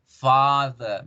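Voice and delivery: "Father" as FAAH-thuh, long open first vowel; lengthened A. Shown as a demonstration. Teacher shows the long vowel, lengthened A. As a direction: stretch the A in "Father", end on schwa. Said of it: "alargo la vocal A".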